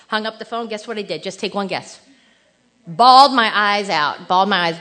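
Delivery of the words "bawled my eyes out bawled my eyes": loud, half-laughing admission of bawling in the car; loud dramatic retelling. Retold or imitated. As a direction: belt it out like a confession of a big cry. Crying after son's call.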